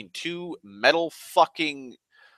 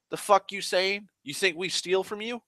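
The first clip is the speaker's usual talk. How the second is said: higher, challenging tough guy; confrontational; 9 semitones higher than usual. Retold or imitated. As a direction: get in his face, offended. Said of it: "they're like".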